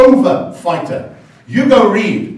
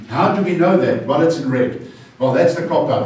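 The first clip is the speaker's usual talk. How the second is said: ordinary talk, nothing marked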